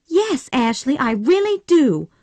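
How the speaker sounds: high, eager yes; a bright, eager, heartfelt reply; eager cry. A narrator's reading. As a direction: Scarlett crying out her yes to Ashley. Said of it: "cried Scarlett".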